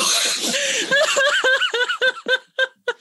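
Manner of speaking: howling with laughter; big laugh. Real, not acted. After "how dare you.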